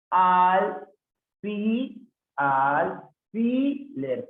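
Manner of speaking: "alfiler" sounded out very slowly, al-fi-ler; syllable by syllable. Shown as a demonstration. Teacher testing the separación, "alfiler" in slow syllables. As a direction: hold each piece of "alfiler". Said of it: "la separación en alfiler".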